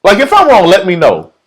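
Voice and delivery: high, loud appeal; calling out. Real, not acted. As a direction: call out to the crowd. Calling out to audience.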